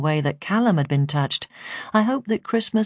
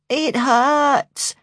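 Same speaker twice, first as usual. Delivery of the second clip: high, drawn-out moan of pain; 9 semitones higher, 3 dB louder than usual. A narrator's reading. Jodie answers her carer about the pain, high moan. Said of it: "she moaned".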